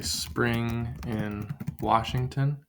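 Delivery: slowly, each syllable set apart; slow poem line. Shown as a demonstration. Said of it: "Maybe I say".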